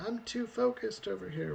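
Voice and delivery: voicing Ronnie. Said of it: "Ronnie's saying".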